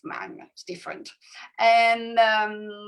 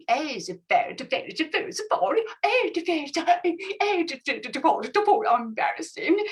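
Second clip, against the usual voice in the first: high, stammering made-up language; pretend-language with a real word; fake foreign language; 6 semitones higher, faster than usual. Shown as a demonstration. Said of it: "sometimes put a word of the language, but you just pretend".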